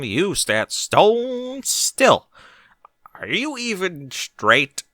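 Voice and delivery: slow, drawn-out taunting voice; mock him with slow relish; teasing mare. A narrator's reading. Barry taunting frozen Bucky, slow teasing drawl.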